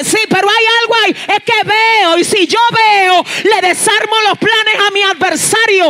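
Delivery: mock-jealous wife pressing her point; insistent wife. Jealous wife insisting to her husband, loud and high.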